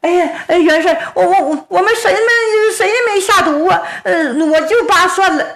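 high, trembling servant's plea; frightened pleading. Acted. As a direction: beg for your life, near tears. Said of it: "大家伙一听".

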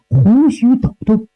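usual speaking voice

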